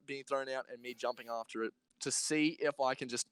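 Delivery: ordinary delivery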